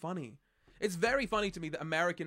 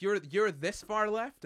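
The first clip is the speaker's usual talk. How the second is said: slow, louder, higher incredulous "this far left?"; mock shocked question; 6 semitones higher, 5 dB louder, slower than usual. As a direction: play the shocked commenter, drawn out. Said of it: "they're like".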